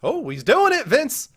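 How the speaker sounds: high excited hype. Real, not acted. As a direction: hype up the big risk. Host excited at risky guess.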